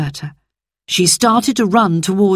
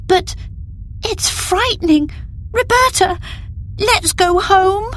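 frightened. A narrator's reading. Scared little girl voiced.

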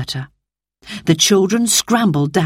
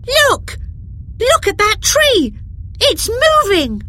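put-on much higher, excited child's cry; cried out. A narrator's reading. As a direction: call out in alarm at the moving tree. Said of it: "Then Peter cried out".

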